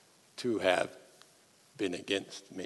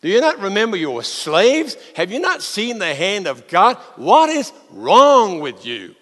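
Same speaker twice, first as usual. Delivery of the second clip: exasperated rebuke; 6 semitones higher, 5 dB louder than usual. Acted. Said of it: "why did Moses just say".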